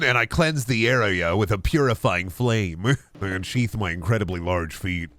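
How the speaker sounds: ordinary talk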